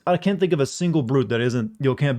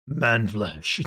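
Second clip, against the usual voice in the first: low growly orc, slightly lower; 4 semitones lower than usual. Shown as a demonstration. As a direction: growl it like a brute. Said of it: "give you my best orc impression".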